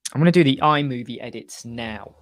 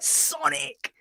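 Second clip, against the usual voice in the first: much higher playful call; high catchphrase call; 12 semitones higher than usual. Retold or imitated. Retelling his office catchphrase, high gleeful call. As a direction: squeal the word out gleefully. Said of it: "I'd be like".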